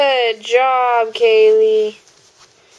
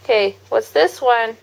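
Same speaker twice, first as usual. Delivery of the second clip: put-on reading to a toddler. Mother reading animal book to toddler.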